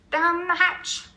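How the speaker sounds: high, loud crowd roar; a rowdy crew toast; shouted. A narrator's reading. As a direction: yell it back all together. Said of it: "we all shouted".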